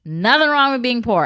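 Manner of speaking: loud, emphatic telling-off, slow then punchy; going off on child. Retold or imitated. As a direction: re-enact an indignant scolding of a child. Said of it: "went off on Anna".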